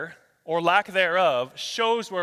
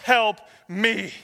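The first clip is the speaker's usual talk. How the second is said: loud, raised cry to the Lord; emphatic, stretched plea for help; pleading cry; 6 semitones higher, 6 dB louder, much slower than usual. Acted. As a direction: let the plea ring out, then draw it out. Climax of verses, acting a plea.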